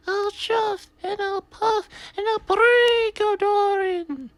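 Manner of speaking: very high, slow wailing threat; ghostly threat. A narrator's reading. Percy as a ghost threatens to break the door, spooky wail. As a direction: huff it out like a big bad ghost.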